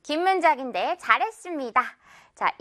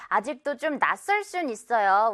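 ordinary talk, nothing marked

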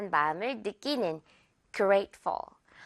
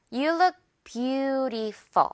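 very slow, light ful; slow demo; 2 semitones higher, much slower than usual. Shown as a demonstration. Teacher modeling the sentence slowly. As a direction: glide slowly through it. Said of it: "F U L 깃털 처리".

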